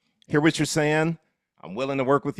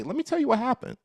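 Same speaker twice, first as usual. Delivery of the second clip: agent storytelling line. Shown as a demonstration. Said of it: "'cause you'll be able to say".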